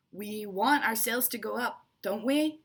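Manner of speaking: rally the room; rhetorical question. Shown as a demonstration. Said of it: "that's a rhetorical question".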